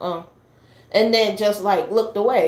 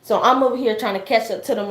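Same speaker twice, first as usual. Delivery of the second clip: ordinary talk, nothing marked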